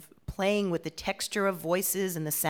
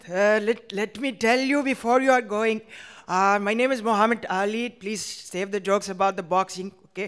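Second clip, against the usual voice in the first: put-on a Middle Eastern-accented man, louder; immigrant accent; 3 semitones higher, 5 dB louder than usual. Muhammad Ali introducing himself before you go, accented. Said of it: "talking to my friend".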